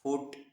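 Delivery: foot with a short u sound; short pesh vowel in foot. Shown as a demonstration. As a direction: say foot with the double O kept short. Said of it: "وہ پیش کی آواز دے رہا ہے".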